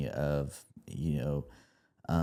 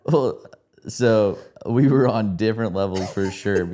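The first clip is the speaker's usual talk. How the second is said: laughing; 4 semitones higher, 7 dB louder than usual. Real, not acted. Teasing reply, laughing.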